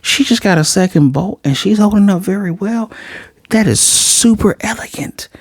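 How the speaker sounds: lightly voiced astonished teacher; shocked teacher thinking. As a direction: marvel at her in your head. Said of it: "He's like".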